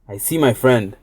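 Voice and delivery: ordinary delivery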